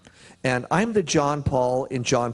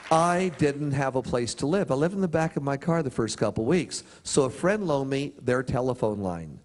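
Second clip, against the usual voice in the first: ordinary delivery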